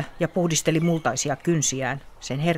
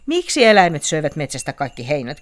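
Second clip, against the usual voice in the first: much louder, baffled why; bewildered question; 9 dB louder than usual. A narrator's reading. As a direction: ask in total confusion. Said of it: "kysyi Otus aivan ymmällään".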